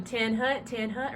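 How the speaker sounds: exaggerated Southern drawl, vowels stretched; Southern accent. Shown as a demonstration. Coach mocks the wrong way of calling, Southern accent. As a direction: draw out the command in a thick Southern accent. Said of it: "Southern accent needs to go out".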